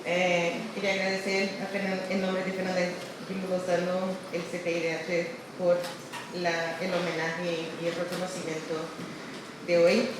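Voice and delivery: Spanish with Portuguese mixed in; portuñol. Real, not acted. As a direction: Brazilian speaking Spanish, Portuguese showing through. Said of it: "perdón por el portuñol".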